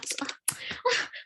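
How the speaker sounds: a breathy giggle, high up; high unvoiced laugh. Real, not acted. Teacher laughs at hard word. (tiếng cười khúc khích cao)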